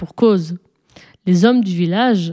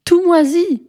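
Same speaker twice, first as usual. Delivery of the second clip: put-on much higher-pitched boyish blurt; thoughtless exclamation; 10 semitones higher than usual. A narrator's reading. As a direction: blurt the name out without thinking. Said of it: "s'exclama étourdiment Antoine". (exclamation étourdie, voix très aiguë)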